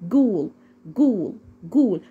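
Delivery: "جول" repeated with hard g, long oo. Shown as a demonstration. Teacher drills learners on ghoul, "جول" with hard g. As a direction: drill ghoul as "جول", geem sound first. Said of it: "حرف الج بالظبط".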